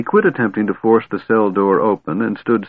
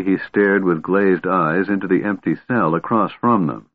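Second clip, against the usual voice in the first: ordinary delivery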